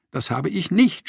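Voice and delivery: half-hearted shouted denial in a quarrel. Acted. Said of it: "schrie Molly zurück".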